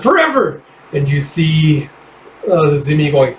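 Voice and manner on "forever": much higher cry of her name; anguished cry. Acted. A teammate crying out as Forever is stabbed, high cry. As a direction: scream her name as she falls. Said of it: "he's like".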